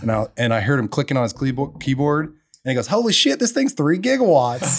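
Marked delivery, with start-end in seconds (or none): 2.9-4.8 much higher, louder amazed outburst with a laugh; mock astonishment. John sees the gigawatt site, hammed-up shock. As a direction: imitate John gasping at the numbers.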